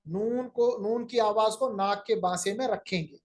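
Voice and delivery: ordinary delivery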